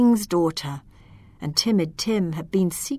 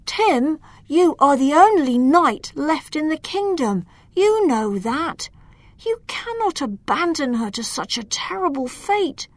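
higher, urgent advisor voice; pleading Hector; 8 semitones higher than usual. Acted. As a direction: press him hard, a bit higher.

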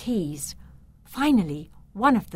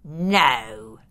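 low, cold refusal; menacing uncle; 6 semitones lower, 4 dB louder than usual. A narrator's reading. The Count refuses his nephew's plea, menacingly. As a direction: refuse in a deep villain voice. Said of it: "replied his uncle, his eyes glinting".

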